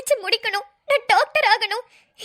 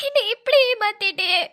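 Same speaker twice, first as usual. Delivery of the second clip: ordinary talk, nothing marked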